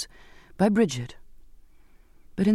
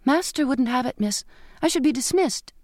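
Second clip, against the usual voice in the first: anxious maid; 7 semitones higher than usual. A narrator's reading. Frightened maid explains.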